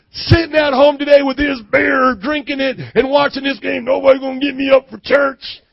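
put-on loud, high country-folk accent; hammed-up rural accent, hollered out. Preacher mocking man skipping church, loud country accent. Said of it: "That's how they probably sound in Algonquin".

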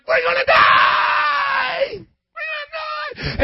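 high, drawn-out panicked shriek; freaking out. Acted. Preacher acting fishermen caught in a deadly storm, panicked scream. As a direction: scream it like terrified fishermen, stretching the last word. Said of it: "the fishermen were freaking out, going".

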